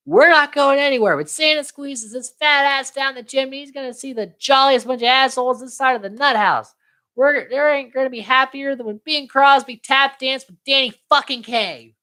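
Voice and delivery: high-pitched, worked-up tirade; mock manic rant. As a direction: rant like a dad who has snapped. Fed-up father's rant, retold.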